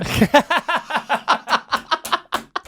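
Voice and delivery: real laugh bursting up high; high burst of laughter. Real, not acted. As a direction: let a big laugh break out. Laughing at own story.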